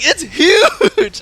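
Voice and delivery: very high, loud, whiny cry through laughter; hammed-up dismay while cracking up; imitated wail. Girlfriend protests the pad's size, imitated high wail.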